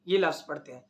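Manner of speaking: ordinary talk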